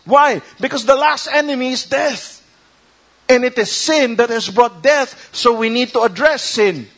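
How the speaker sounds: preaching at full force. Acted. Preacher urging the church toward Christ, full-force preaching. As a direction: drive the point home to the congregation.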